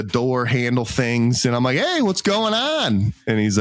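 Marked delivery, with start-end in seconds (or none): 1.8-3.1 high, drawn-out friendly hail; casual call-out. Retold or imitated. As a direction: call out slowly in a raised, sing-out pitch. Greets a stranger, contrasted with reply.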